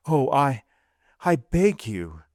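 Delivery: high, halting, pleading; stammered plea. A narrator's reading. Accused wife begs her husband, stammering. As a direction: break off and restart as you beg. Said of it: "she stammered".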